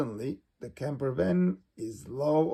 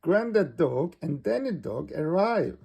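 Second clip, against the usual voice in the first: ordinary delivery, nothing marked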